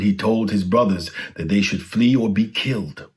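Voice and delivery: usual speaking voice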